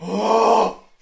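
big, loud, high gasp of delight; loud excited gasp. Real, not acted. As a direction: gasp as if thrilled. Excited about Reverse Flash.

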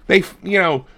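usual speaking voice

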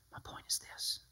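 hushed whisper to make the point. Real, not acted. Pastor answering his own question to the congregation, whispered. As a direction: lean in and whisper it.

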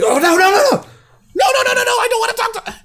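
high, fast panicked no's; panic, then gush sweetly; panicked then fake-sweet. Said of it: "you called your grandma and you're like".

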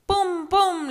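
loud high bang bang; gunshot sounds. Acted. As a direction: shout the shots. Sonido de disparo. (disparos imitados)

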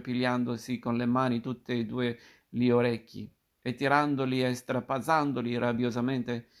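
usual speaking voice

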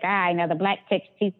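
snide gossiping coworker voice, a different timbre; mocking catty coworker. Coworkers gossiping about her over AOL, mocking snide voice. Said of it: "These voices are hilarious".

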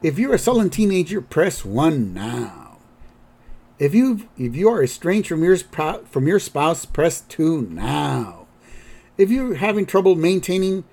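lightly imitated phone menu, stretching each "now"; recorded hotline greeting. Recorded anxiety line greeting Grandpa, singsong menu voice. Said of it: "we see Grandpa on the phone".

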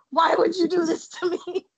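high, tearful mock wail; hammed-up, high-pitched sobbing protest; mock crying. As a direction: whine it as if bursting into tears. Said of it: "Immediately start crying".

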